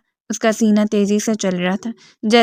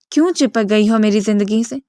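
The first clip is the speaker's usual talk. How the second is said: usual speaking voice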